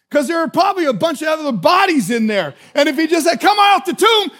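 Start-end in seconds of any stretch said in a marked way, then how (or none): 3.4-4.3 high, loud shout; loud summons. Acted. Jesus calling every body in the tomb, high loud shout. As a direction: shout it out to a whole crowd. Imagined call to all the dead.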